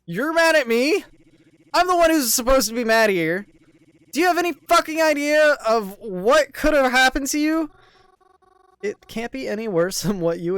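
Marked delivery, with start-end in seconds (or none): 0.1-7.7 loud, furious yelling at her; angry shouting. Acted. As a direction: bark it at her, fuming. Krovan furious, raised voice.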